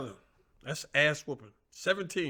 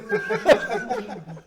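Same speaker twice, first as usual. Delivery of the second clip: high laugh; 3 dB quieter than usual. Real, not acted. Big laugh.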